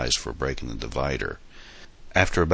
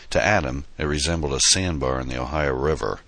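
ordinary delivery

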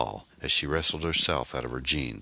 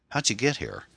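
stupefied; 8 semitones higher than usual. A narrator's reading. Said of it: "stupefied and in shock".

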